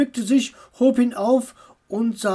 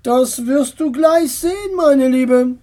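aunt voice; 5 semitones higher, 5 dB louder than usual. Acted.

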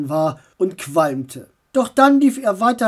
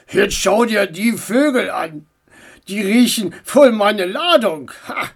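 driver voice; 4 dB louder than usual. Acted. Driver voice again.

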